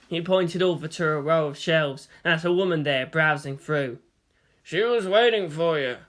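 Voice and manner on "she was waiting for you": sly shopkeeper. Acted. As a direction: tease him with a knowing question. Owner about the woman.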